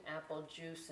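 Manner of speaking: normal talking voice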